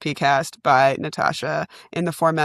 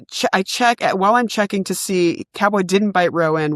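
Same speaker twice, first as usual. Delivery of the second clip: usual speaking voice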